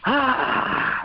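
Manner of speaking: big gasp, then a high cry; acted gasp of relief. Acting out sudden relief after scare.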